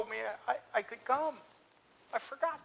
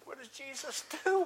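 ordinary talk, nothing marked

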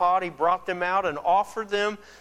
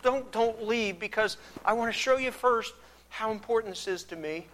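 higher, stumbling, urgent plea to God; hesitant pleading; 6 semitones higher, 3 dB louder than usual. Acted. Gideon begs God to wait for his offering, hesitant pleading. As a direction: play an unsure man begging God to stay.

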